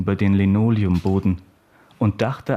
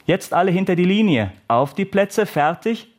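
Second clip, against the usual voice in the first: high, raised starting commands; coach calling out; 9 semitones higher than usual. A narrator's reading. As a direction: call the runners to their marks. Voiced coach starts a sprint.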